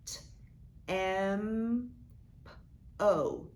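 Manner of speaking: T and P as bare puffs, M as "emmm", O as "oh"; T, M, P, O as separate slow sounds; sounded out. Shown as a demonstration. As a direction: segment with pauses: t, emmm, p, oh. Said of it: "when I put this back together".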